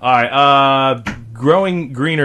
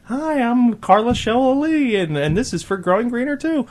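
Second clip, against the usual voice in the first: mock TV ad host; 13 semitones higher than usual. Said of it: "and it's like".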